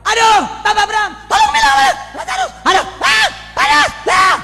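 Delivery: very high, loud screaming cry to Abraham; anguished screaming plea. Acted. The rich man in hell screams to Abraham for help, shrieking high.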